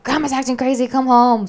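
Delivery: loud, high-pitched retold alarm; panicked plea. Girl phoning her dad about grandma, imitated panicked shout. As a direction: cry for help, imitating a panicked kid.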